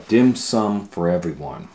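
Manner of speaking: usual speaking voice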